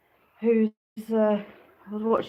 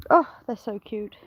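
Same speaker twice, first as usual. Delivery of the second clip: usual speaking voice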